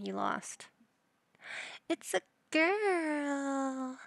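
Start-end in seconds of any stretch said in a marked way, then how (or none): 1.9-4.0 excited announcement. Real, not acted. Baby born in game.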